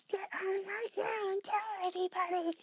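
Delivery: high, bossy mock command; mock shrill demand. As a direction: mimic her ordering him home. Mocking her phone demand.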